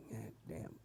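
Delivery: ordinary talk, nothing marked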